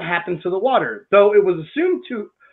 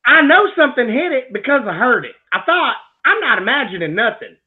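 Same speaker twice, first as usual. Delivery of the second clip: hammed-up Tennessee country accent, pitched up a little; 4 semitones higher than usual. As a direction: read it as a Tennessee country witness. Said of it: "I'm doing the Tennessee accent".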